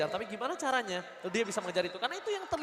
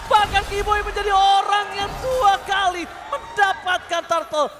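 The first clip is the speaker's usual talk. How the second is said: excited play-by-play shouting; high, loud hype call; esports caster; 10 semitones higher, 11 dB louder than usual. Real, not acted. Caster yells live teamfight.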